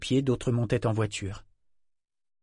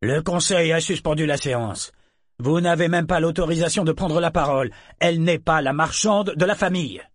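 loud, high-pitched official rebuke; raised voice; 6 semitones higher, 4 dB louder than usual. A narrator's reading. Guard overriding protests in the council hall, loudly. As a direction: announce it forcefully over the protests. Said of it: "ajouta-t-il à voix forte".

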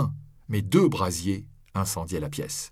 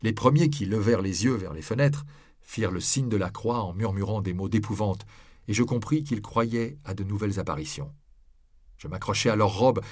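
plain reading voice

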